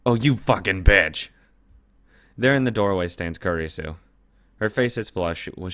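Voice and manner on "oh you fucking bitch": real shocked curse, louder; angry outburst. Real, not acted. Player cursing at the screen, sudden angry shout. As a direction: blurt it out in disbelief.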